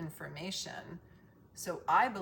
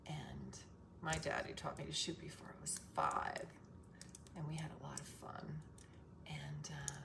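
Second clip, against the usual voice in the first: whispering; 3 semitones lower, 9 dB quieter than usual. Real, not acted. Whispered childhood memory.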